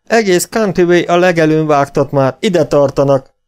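loud, high warning cry; panicked bandit shouting a warning; alarmed shout. A narrator's reading. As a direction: shout the alarm across the camp. Bandit warns of posse.

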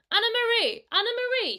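high, loud squeaking rat; squeaked. Acted. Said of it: "squeaked the rat".

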